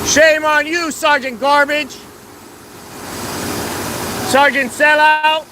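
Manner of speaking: high, loud mocking yell; loud name-calling heckle; taunting shout. Real, not acted. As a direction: jeer at him by rank. Protester taunting a sergeant.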